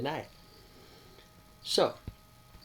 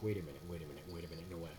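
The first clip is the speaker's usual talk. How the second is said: low, hushed wait a minute; stunned realisation; 6 semitones lower, 9 dB quieter, much faster than usual. Retold or imitated. Catching himself mid-panic, hushed stunned disbelief. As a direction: mutter it to yourself in disbelief. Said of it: "And I'm like".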